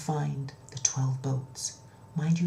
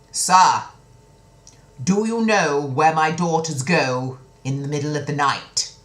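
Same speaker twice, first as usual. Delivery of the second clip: loud, commanding royal demand; demanding king; 9 dB louder than usual. Acted. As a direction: boom it out like a stern king. King demands answer from soldier.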